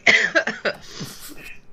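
spluttering coughing fit; coughing up water. Real, not acted. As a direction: cough after drink went down wrong. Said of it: "It just went down the wrong way".

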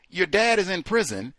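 normal talking voice

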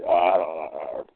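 lightly voiced dog. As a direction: mumble along as the dog deciding. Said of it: "she was talking like the dog".

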